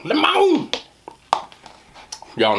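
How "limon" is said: high falsetto limon. Shown as a demonstration. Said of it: "Let me tell you the limon story".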